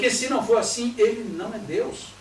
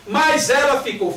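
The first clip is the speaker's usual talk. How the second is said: louder, slow and indignant; indignant mother; 6 semitones higher, 8 dB louder, slower than usual. Retold or imitated. Mother protesting the celebration, loud slow objection. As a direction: object hard, louder and slower.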